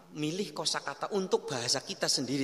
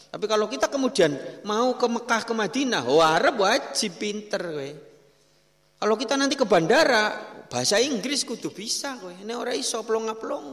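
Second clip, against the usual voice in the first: much louder, emphatic; loud preaching; 5 semitones higher, 9 dB louder than usual. Acted. Preacher warning pilgrims about Arabic and English, loud. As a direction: warn them with a raised voice.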